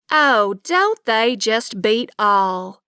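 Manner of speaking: slow, stretched Southern drawl. Acted. Neighbor chuckling about boys, slow Southern drawl. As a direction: draw every word out, Texas style. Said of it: "she drawled".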